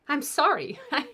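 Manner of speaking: ordinary talk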